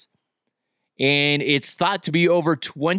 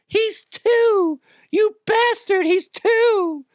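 squeaky high indignant voice, much higher; hammed-up high-pitched protest; mock outrage; 3 dB louder, much slower than usual. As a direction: shriek it like a scandalized bystander. Mock outrage on toddler's behalf.

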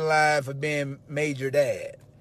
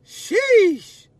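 high, loud, stretched sheesh; amazed sheesh; 11 dB louder than usual. Real, not acted. Host marvelling at Sheldon's game, squealed sheesh. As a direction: let out a squealed sheesh of awe.

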